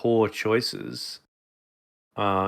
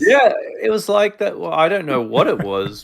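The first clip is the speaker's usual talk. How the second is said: mock alien voice; 9 semitones higher, 8 dB louder than usual. Still doing the alien voice.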